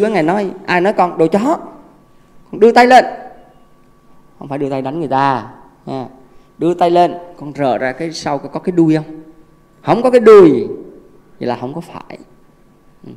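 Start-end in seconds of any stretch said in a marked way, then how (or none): 2.5-3.0 loud command. Retold or imitated. Master's advice, raise your hand. 9.8-10.7 emphatic exclamation. Acted. Emphatic punchline, no tail.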